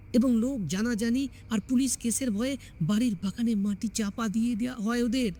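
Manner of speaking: ordinary delivery, nothing marked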